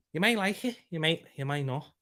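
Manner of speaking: put-on light playful Scouse accent; a hint of Liverpool Scouse. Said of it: "Would you like my Scouse accent".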